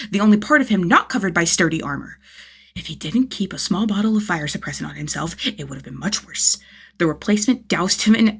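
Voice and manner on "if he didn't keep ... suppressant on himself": ordinary delivery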